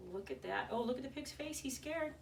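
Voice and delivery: ordinary delivery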